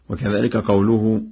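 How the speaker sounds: ordinary delivery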